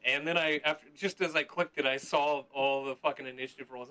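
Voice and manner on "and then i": ordinary talk, nothing marked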